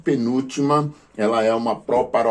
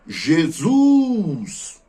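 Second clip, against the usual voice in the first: Jesus called out high as if to someone far away, zus stretched; calling out; 8 semitones higher, 3 dB louder than usual. Shown as a demonstration. Teacher calls out Jesus to show the stressed syllable. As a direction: shout Jesus across a field, landing on zus. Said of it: "como se estivesse gritando".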